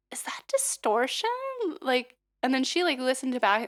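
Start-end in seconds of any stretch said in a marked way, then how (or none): 0.1-1.3 whispering, imitating the coach; breathless hushed question; whispered question. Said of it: "unvoiced whisper".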